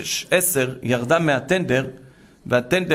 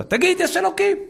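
shouted order. Retold or imitated. Captor orders captive to say it.

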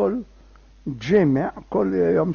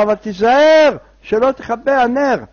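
high, louder cautioning call; urgent warning; 6 semitones higher, 6 dB louder than usual. Acted. Helper warns him about the candle, urgent raised warning. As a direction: warn him firmly, voice raised.

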